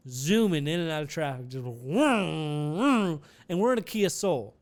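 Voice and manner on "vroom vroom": revving car noise with swooping pitch; imitated engine vroom. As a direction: mimic a car zooming past. Imitating the car zooming through traffic.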